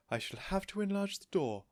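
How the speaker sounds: a light worry; worried Mrs Silver. A narrator's reading.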